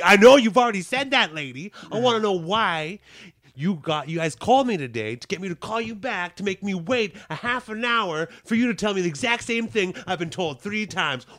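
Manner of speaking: angry customer ranting at the clerk. Retold or imitated. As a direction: let the frustration boil over.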